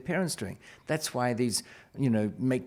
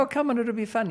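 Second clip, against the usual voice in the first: high-pitched coaxing; coaxing parent; 10 semitones higher, 3 dB louder than usual. Retold or imitated. Dad talking daughter into an open house, coaxing. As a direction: coax a reluctant kid along.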